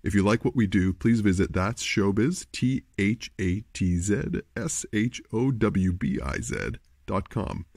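ordinary talk, nothing marked

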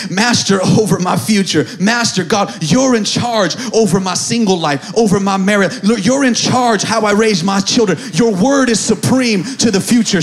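loud, driving declaration; preacher declaring God master over every area of life, forceful; full-force preaching. Real, not acted. Build it loud, phrase upon phrase.

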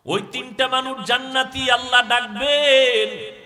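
loud, high chanted call; sermon proclamation. Acted. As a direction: proclaim it at full force, drawing out the ending. Preacher proclaims who is saved.

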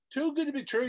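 mock the idea with a high, scoffing echo of good; incredulous echo. Shown as a demonstration. Coach scoffing at viewers' dream of easy money, incredulous high echo. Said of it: "Does that sound too good to be true?".

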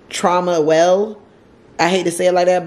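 normal talking voice

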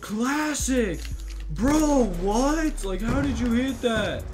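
loud, high mock disbelief; imitated amazed yelling. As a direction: yell like a stunned fan, hammed up. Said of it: "yelling at me because I just hit something".

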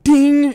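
very high, loud bell-like ding; mock alert ding. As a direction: chime like a notification sound. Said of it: "you're like".

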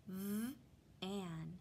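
"V-an" split into onset and rhyme; sounding out words. Shown as a demonstration. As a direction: say "V-an" with a break after the V.

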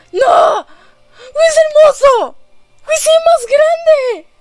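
high, loud, near-tearful delight; overjoyed cry. Real, not acted. Gamer seeing Sora's new design, near tears with joy. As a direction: cry out in love with it. Overwhelmed by the character's new look.

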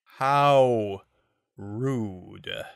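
drawn-out mock offense; drawled. A narrator's reading. Panther drawling mock offense at Miyu. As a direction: drawl it lazily. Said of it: "Panther drawled".

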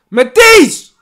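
loud, high, fast scolding shout; furious yell. Real, not acted. Fan berating his team's goalkeeper, loud furious yell. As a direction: tear into the keeper at full volume.

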